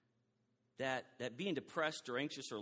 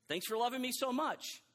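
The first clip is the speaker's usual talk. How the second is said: higher, louder, glad thanks; grateful thanks; 8 semitones higher, 3 dB louder than usual. Shown as a demonstration. Pastor contrasting prayers, gratefully receiving God's love. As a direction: receive the love gladly, brightly lifted. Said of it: "It was a whole different thing".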